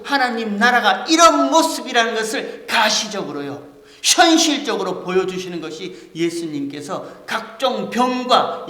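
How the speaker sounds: loud, high, impassioned preaching; full-force preaching. Acted. Pastor preaching to the congregation, loud and impassioned. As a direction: drive the point home to the congregation.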